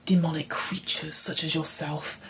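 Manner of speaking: soft, breathless disdain; sneering whisper. Acted. NPC sneering at the little demon, whispered. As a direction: hiss it down at the creature.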